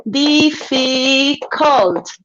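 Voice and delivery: Difficult stretched out slowly, each syllable split apart: dif-fi-cult. Shown as a demonstration. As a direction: spell out the three beats of Difficult slowly. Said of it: "One, two, three syllables".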